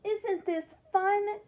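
high, slow delighted little dog; gleeful giggle. Acted. Friend Trevor enjoys the photo shoot, high gleeful voice. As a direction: gush with glee. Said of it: "giggled Trevor with glee".

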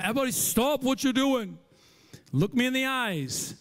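high, loud dad halting everyone; commanding father. Acted. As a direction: stop the room, then draw out the question. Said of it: "I stop all the chaos".

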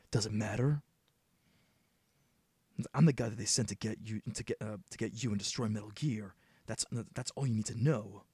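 low, terse hardened agent; low gruff soldier voice. Acted. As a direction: keep it low and blunt like a veteran soldier. Player voices Snake.

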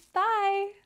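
plain reading voice

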